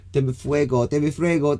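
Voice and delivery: hammed-up Spanish accent, pitched high; cliched Spanish accent. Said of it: "when we do it, they go".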